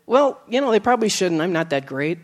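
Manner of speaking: ordinary talk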